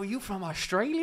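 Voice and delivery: lightly hammed-up American tourist; laughing as you mimic the American question; mock American asking. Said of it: "they go".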